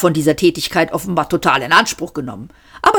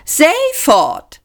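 much higher, sing-song fluted name; fluting; 11 semitones higher than usual. A narrator's reading. Ford greeting his cousin in a fluting voice. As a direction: flute it sweetly. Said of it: "flötete er".

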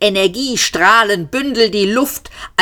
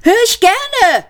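high shout back; 3 dB louder than usual. A narrator's reading. Seyford shouts back at the police, high. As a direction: yell the reply over the gunfire. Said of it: "brüllte Seyford zurück".

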